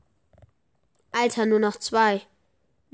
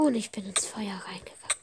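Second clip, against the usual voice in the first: soft dismayed whisper; 7 dB quieter than usual. Real, not acted. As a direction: mutter it under your breath. Walked into fire in game.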